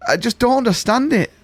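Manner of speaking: high, incredulous outburst; exasperated disbelief. Real, not acted. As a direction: voice climbs in sheer bafflement. Exclaims in disbelief about the woman.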